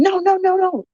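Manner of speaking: retold cries of protest, voice jumping high; imitated yelling. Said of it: "started yelling".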